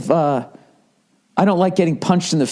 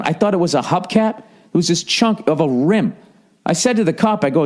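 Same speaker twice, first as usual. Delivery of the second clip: ordinary talk, nothing marked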